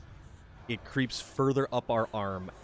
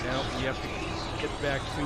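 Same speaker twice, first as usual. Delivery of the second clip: ordinary delivery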